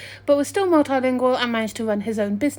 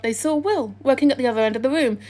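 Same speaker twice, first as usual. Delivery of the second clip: usual speaking voice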